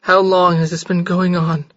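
plain reading voice

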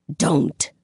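words forced through gritted teeth. A narrator's reading. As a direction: grit your teeth on it. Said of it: "She speaks through her teeth".

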